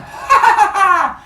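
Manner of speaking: high, smug ha ha; girl in padded room gloating; mock gloating laugh. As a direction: laugh at fate from safety. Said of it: "she sits there going".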